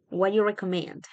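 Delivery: "what you" blended into "wha-you"; reduced question, "what" gliding into "you"; dropped "do". Shown as a demonstration. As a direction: leave "do" out entirely. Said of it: "solamente va a sonar what you".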